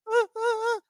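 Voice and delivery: high-pitched mock-helpless "uh" noises; panicked stammer. Newcomer ordered to loot a body, high panicked stammering. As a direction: stammer high, as if frozen on the spot.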